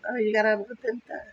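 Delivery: high teasing line through laughter; laughing tease. Retold or imitated. Telling a friend who left sick she got out, teasing, high. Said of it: "You're just like".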